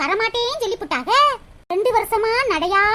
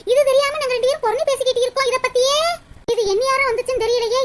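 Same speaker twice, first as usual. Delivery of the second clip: ordinary delivery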